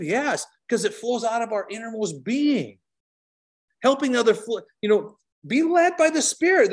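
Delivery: lifted, fervent preacher's cry; preaching at full force. Acted. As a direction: preach it out with fire.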